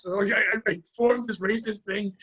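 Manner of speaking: normal talking voice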